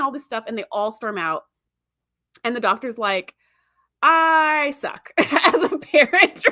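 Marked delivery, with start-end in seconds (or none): 4.0-6.4 drawn-out "I", breaking into laughter; mock defeat, high and loud, laughing through it; laughing confession. Doctor's comic confession, teller laughing.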